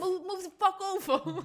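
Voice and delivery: high, loud mock shout; mock angry yell. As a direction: play the rude New Yorker. Mock angry New Yorker.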